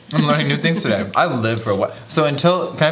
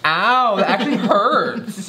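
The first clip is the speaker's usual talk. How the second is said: laughing pain; 9 semitones higher, slower than usual. Real, not acted. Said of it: "A S M R".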